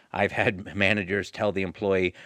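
ordinary talk

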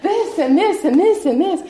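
high, loud list of woes; mock lament. Speaker likens herself to Job, mock lament. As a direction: heap them up in an exaggerated complaint.

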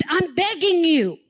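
earnest plea, lightly acted; a touch of urgent begging; Paul begging. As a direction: plead with them as the apostle. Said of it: "Can you hear this in his voice?".

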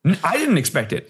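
usual speaking voice

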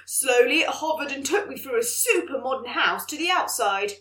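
lively storybook reading voice; story narration. Acted. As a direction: read it like an excited story. Reads child's story aloud.

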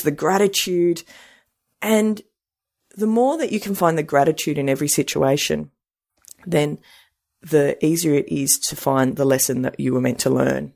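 ordinary talk

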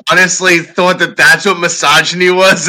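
loud, high incredulous question; mock the naivety; incredulous wife. Wife incredulous at the comic's idea of misogyny. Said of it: "It's like".

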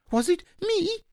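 very high squeaky innocent question; tiny creature puzzled at being blamed; a little surprised. A narrator's reading. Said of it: "a little surprised".

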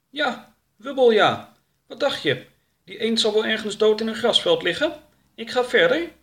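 high-pitched, huffy creature voice; offended, high and animated; indignant Wubbel. Acted. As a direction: tell off the travel companion in a high voice.

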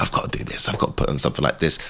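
whispered to himself; whispered thought. Retold or imitated. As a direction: breathe it out as a secret resolve. Inner thought during the show.

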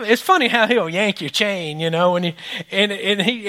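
usual speaking voice

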